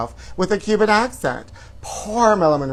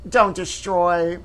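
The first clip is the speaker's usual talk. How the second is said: Jamie Lynn voice; 3 semitones higher, much slower than usual. Retold or imitated. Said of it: "phone call from Jamie Lynn".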